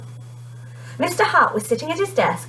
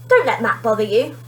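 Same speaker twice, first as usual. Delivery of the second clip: ordinary delivery, nothing marked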